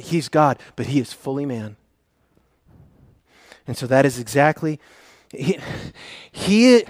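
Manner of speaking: ordinary talk, nothing marked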